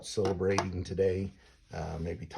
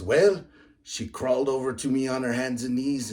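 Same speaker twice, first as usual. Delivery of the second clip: higher, louder hokey Irish brogue; terrible Irish accent; 5 semitones higher, 7 dB louder than usual. Retold or imitated. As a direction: deliver the setup of the punchline in a mock Irish voice. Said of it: "terrible, uh, accent".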